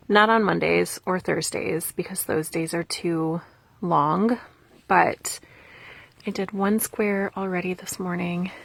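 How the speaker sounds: ordinary talk, nothing marked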